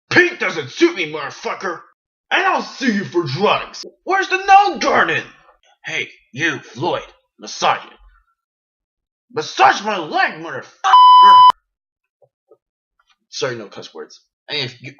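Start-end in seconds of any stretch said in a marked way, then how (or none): 0.1-11.3 loud, raging, high-pitched crazed rant, slow and shouted; Trevor Phillips impression. Vlogger doing his Trevor impression as a raging loud rant. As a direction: scream the abuse like a crazed thug. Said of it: "my perfect Trevor Phillips impersonation".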